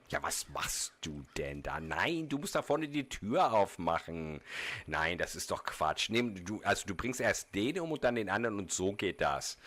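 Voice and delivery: rough, rapid German ranting, imitated; imitating a German streamer mid-game; mock German gamer chatter. Streamer mimics a German player to his chat, rough German chatter.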